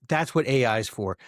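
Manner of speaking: ordinary talk, nothing marked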